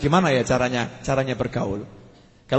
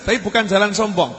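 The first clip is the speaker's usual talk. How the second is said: ordinary talk, nothing marked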